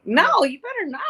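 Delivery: you better not, wagging a finger; playful warning. Real, not acted.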